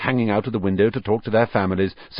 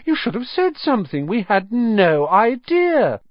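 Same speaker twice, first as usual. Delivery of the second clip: sarcastic teasing; 11 semitones higher, 3 dB louder than usual. Acted. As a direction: tease him with fake innocence. Twin teasing Percy.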